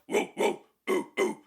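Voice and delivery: breathy unvoiced woofs like a dog; dog bark imitation. Shown as a demonstration. Vocal coach showing viewers a dog-bark exercise, airy woofs. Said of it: "mimicking, like, a dog bark".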